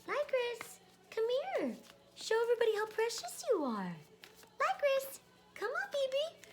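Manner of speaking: very high cooing pet voice; high sing-song call to a pet. Real, not acted. Calling the cat to show off.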